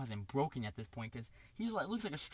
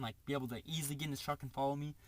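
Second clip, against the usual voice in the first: normal talking voice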